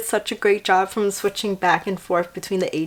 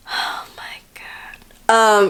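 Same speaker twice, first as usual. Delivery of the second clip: slow breathless whisper; slow whispered oh my god; awed whisper; much slower than usual. Retold or imitated. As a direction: whisper it in awe. Amazed reaction to the scene.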